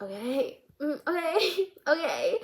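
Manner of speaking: awkward okays. Retold or imitated. Student reacts to counselor's overshare.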